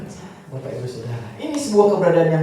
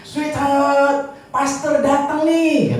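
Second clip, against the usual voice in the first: higher, sweet calling voice; grandpa calling wife; 10 semitones higher, much slower than usual. Retold or imitated. Grandpa calls his wife to greet the pastor, higher and sweet. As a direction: call out fondly to the wife in the next room.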